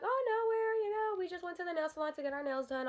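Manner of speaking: high, soft, quick fib; playing innocent. Teller answering Mama on arriving home, feigning innocence. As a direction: brush it off in a sweet high voice.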